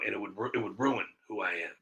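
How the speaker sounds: ordinary talk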